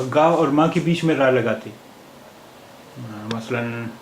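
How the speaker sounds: ordinary talk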